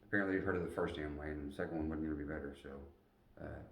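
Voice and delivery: ordinary delivery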